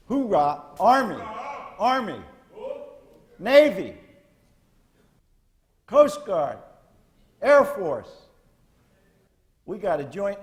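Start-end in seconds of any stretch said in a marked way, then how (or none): 0.1-8.1 high, punchy shouts to the crowd; loud roll call. Real, not acted. Speaker rouses veterans branch by branch, loud roll call. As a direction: call out each branch like a drill officer.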